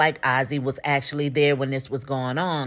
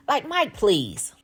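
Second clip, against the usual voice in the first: exasperated dismissal; 7 semitones higher, slower than usual. Real, not acted. Dismissing the lawyer's accusation.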